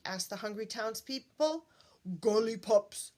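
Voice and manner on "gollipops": monster voice. Acted. Hungry thing demands food.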